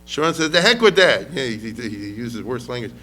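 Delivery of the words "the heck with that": dismissive. Retold or imitated. Sharon dismisses the generals.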